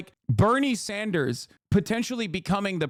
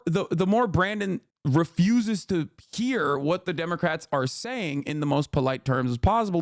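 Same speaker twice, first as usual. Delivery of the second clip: ordinary delivery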